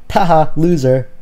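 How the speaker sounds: loud taunting laugh and jab; mocking jeer. Inner voice mocking the failed attempt, jeering. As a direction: jeer at the failure.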